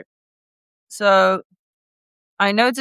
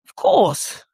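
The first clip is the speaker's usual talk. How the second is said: lightly put-on British English accent; a posh-sounding British English reading voice. Offering to read stories, in a British English accent. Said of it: "in my British English voice".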